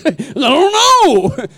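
high, drawn-out mock whine; mock wail. Preacher mimics a man dodging God's call, mock wail. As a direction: wail it slowly like a helpless complainer. Acting out man wailing his excuse.

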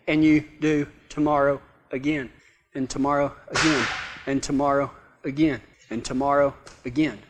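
low, soft, with pauses between repeats; droning repetition. Acted. Endless putting off, repeated wearily.

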